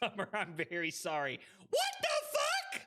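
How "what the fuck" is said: high startled yell; shocked shout. Real, not acted. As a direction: shriek in disbelief. Shocked at the game.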